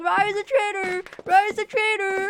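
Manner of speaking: high, loud panicked shouting; mock panicked yell; frantic accusing yell. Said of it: "It's like".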